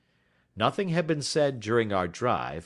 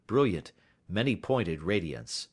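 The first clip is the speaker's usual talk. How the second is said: ordinary delivery, nothing marked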